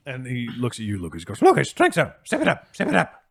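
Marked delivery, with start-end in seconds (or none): 1.4-3.2 put-on high, loud coaxing old voice; coaxing old man. Herb coaxing Lucas to sip from the bucket, high coaxing voice. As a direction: urge him to sip.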